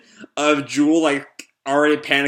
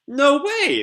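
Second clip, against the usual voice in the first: much higher, delighted exclamation; real surprise; 13 semitones higher, 3 dB louder than usual. Real, not acted. The host reacts to the fake Sonic, surprised. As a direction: burst out in amused disbelief.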